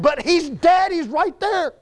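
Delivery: high, strained insistence; anguished protest. Acted. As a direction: plead angrily against the obvious. Martha protesting to Jesus about Lazarus.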